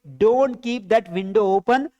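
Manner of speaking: very slow, word by word, for the class; slow model sentence. Shown as a demonstration. Teacher dictating an English sentence to students, very slowly. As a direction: spell each English word out slowly for learners. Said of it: "तो क्या बोलोगे?".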